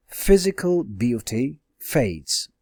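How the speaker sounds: "Physical beauty fades" spoken slowly with stress on "physical"; slow it down and lean on "physical"; slow, deliberate repeat. Shown as a demonstration. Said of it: "This physical beauty is your subject".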